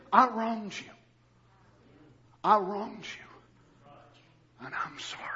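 quiet, slow, fading into a whisper; soft broken confession. Acted. Preacher voicing a humble confession, hushed and slow. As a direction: let the apology sink to a whisper.

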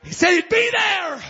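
high, loud held-out proclamation; preaching shout. Real, not acted. Preacher assuring the congregation, shouting. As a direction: cry it out to the congregation.

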